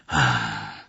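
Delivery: long contented sigh then a low ah; a hint of a sigh before speaking. A narrator's reading. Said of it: "he sighed".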